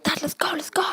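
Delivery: high, whispery panicked plea; acted-out hushed panic; retold urgency. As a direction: urge Dad to get away, high and breathless. Fleeing to dad with the card.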